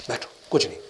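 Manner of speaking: gentle calming. Retold or imitated. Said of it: "دیکھو کتنی نرمی کا معاملہ".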